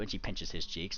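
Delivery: ordinary talk, nothing marked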